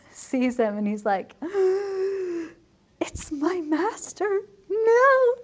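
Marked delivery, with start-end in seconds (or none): 1.4-2.5 mock gasp. Tranio sees his master arrive. 3.0-5.3 high, slow dismay rising to a yelp on no; hammed-up horror at being caught; mock panic. Tranio panics at seeing master.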